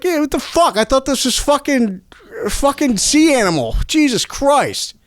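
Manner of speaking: high, indignant surprise at being fooled; mock disbelief. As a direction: act stunned that it's only a tree. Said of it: "I was like".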